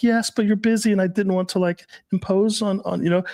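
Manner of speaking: put-on high-pitched, apologetic girlfriend voice; imitated submissive girlfriend. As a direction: imitate her not wanting to impose. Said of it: "She's like".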